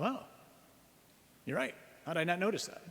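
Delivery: high, hammed-up dismay at his own mistake; mock surprise. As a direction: feign shock, voice jumping up. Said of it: "I go".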